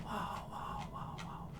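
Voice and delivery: ordinary talk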